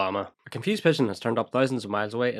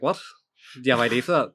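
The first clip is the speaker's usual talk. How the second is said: louder, incredulous repeat of the question; indignant echo; 3 semitones higher, 5 dB louder, faster than usual. Acted. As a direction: throw the question back in mock outrage. Indignant customer repeats the question back.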